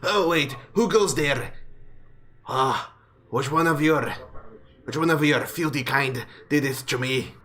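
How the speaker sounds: put-on alarmed, accented dwarf challenge; lightly voiced indignant dwarf; startled dwarf. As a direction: challenge them like a dwarf just shaken awake. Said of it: "alarmed with wide eyes".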